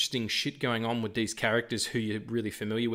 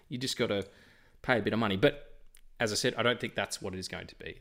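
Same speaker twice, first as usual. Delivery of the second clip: ordinary delivery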